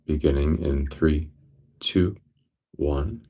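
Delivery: measured meditation count, each number set apart; breath count. Real, not acted. Guide starting listeners on a breath count, measured counting. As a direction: count them into the breath, evenly spaced.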